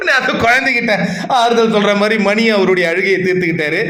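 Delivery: usual speaking voice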